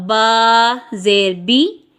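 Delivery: teacher's spelling drill; slow drilled reading. Shown as a demonstration. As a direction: drill it slowly for the class. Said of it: "आवाज़ को नीचे गिरा दिया".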